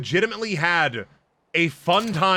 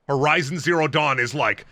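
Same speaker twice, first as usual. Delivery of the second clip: normal talking voice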